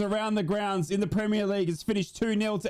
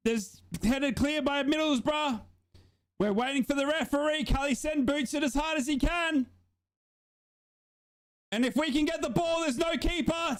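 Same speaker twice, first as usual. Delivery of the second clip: nervous play-by-play; 7 semitones higher than usual. Real, not acted. Tense stoppage-time live commentary.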